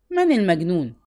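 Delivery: angry question. A narrator's reading. Sheikh woken by a drunk below, angry demand. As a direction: demand it angrily from the window. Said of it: "وتساءل بغضب".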